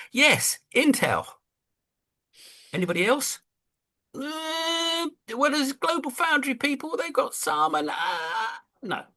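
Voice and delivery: high, hesitant nervous reply; mock advisor. Advisor briefing the big man on chipmakers, mock timid. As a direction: answer the boss timidly. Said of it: "say I'm an advisor".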